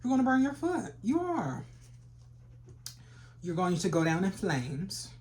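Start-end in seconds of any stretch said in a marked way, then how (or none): 0.0-1.6 high loud warning. Acted.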